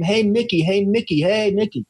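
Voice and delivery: high-pitched, restrained mock scream; mock yell. Kid calling to his idol at batting practice, mock yell. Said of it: "and yell and scream".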